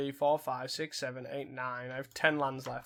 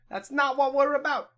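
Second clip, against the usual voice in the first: playful declaration; 10 semitones higher, 4 dB louder than usual. Acted. Joking about deck choice.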